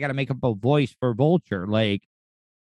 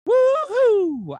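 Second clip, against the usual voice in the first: a shrill little woo-hoo; high squeaky cheer; 4 dB louder than usual. Retold or imitated. Mock cheer as Vulture.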